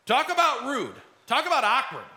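mild indignation. Acted. Funeral bearers reacting to the interruption, mild indignation. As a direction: react affronted and incredulous. Procession's imagined shocked reaction.

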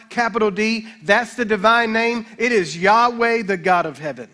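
loud, ringing preacher's voice; full-force preaching. Acted. Preacher proclaiming God's promise to the church, loud and fervent. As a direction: drive the promise home to the congregation.

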